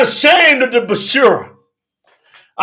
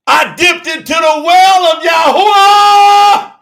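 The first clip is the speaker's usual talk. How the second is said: higher shout; 7 semitones higher, 6 dB louder, slower than usual. Real, not acted. Preacher repeating his testimony to the church, shouting. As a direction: repeat it higher and louder, slow. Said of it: "repeated louder".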